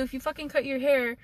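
ordinary delivery

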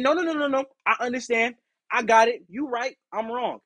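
high, hurried appeasing voice; overeager apology. Retold or imitated. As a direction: smother her with apologies. Eager appeasing apology to manager.

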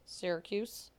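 ordinary talk

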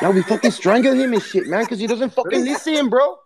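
high-pitched mobster. Acted. High mocking character voice.

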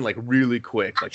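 normal talking voice

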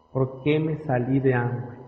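low, slow and hushed lament; retold tearful regret. Colleague between sobs regretting that he left Amway, quiet mournful imitation. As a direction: imitate a man's sorrowful regret, sinking soft and low. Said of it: "entre llanto y llanto".

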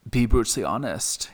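put-on firm German-accented order, lightly done; thick German accent. German teacher ordering the narrator to write, thick German accent. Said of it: "in this thick German accent".

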